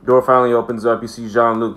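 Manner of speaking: usual speaking voice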